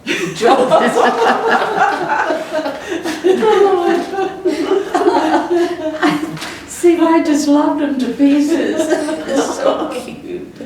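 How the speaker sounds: laughing fondly through the words. Real, not acted. Old teacher to interviewer, laughing with delight. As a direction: giggle it out, high and loud. Recalling loving her pupils.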